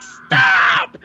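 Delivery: very high, loud cry; girlfriend's mock shriek. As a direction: imitate a woman shrieking in protest. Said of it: "she was just like".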